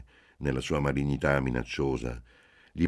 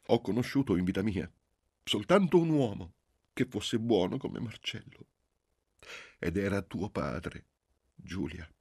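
tearful tender memory; 6 semitones higher than usual. Acted. Tearful mother remembers husband.

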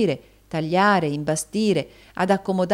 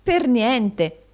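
high, loud echo in amazement; astonished wife's exclamation; exclaiming; 6 semitones higher, 5 dB louder than usual. A narrator's reading. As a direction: marvel at the bargain. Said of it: "esclamò Madama".

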